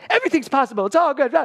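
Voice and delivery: high, loud burst of optimism; over-happy younger self. Retold or imitated. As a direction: chirp it out, relentlessly upbeat.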